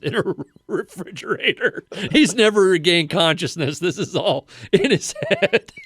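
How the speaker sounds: words broken by laughter; giggling through the punchline; laughing while talking. Real, not acted. Laughing at own dark joke.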